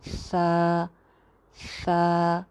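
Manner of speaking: सा said as a lisped th, tongue between the teeth; correct tha. Shown as a demonstration. Teacher showing the right सा after the wrong one. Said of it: "ये आपको आवाज़ आ रही है".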